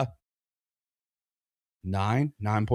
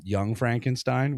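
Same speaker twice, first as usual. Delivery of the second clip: usual speaking voice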